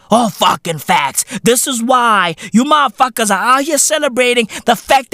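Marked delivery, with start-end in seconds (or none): none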